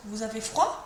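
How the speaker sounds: statement turned question, "froid" going up; rising intonation. Shown as a demonstration. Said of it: "the statement with the rising intonation".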